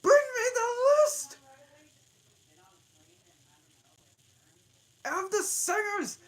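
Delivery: very high, very loud royal command; shrieking queen. Acted. Queen ordering an officer, very high and loud. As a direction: shriek the order across the court. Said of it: "she said".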